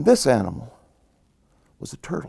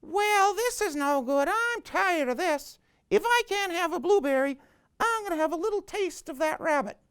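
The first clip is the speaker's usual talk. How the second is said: very high, thin insect voice, softer; fed-up mosquito; 12 semitones higher, 7 dB quieter than usual. Acted. Mosquito tired of waiting threatens rabbit, high whine. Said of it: "the mosquito says".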